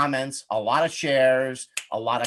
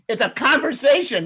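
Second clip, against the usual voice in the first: much higher and louder, delighted punchline; emphatic exclamation; 10 semitones higher, 5 dB louder than usual. Shown as a demonstration. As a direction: announce the obvious answer with a flourish. Realizes the name, repeats it.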